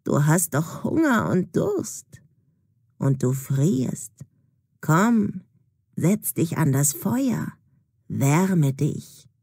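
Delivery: low, slow witch voice luring her in; old woman luring the frozen girl to the fire, low and slow; old crone coaxing. A narrator's reading. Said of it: "sagt die alte Frau".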